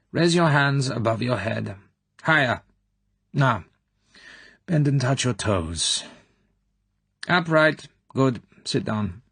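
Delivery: examine her like a doctor would. A narrator's reading. Colonel inspecting the girl, brisk doctor voice. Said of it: "The woman spoke like a doctor".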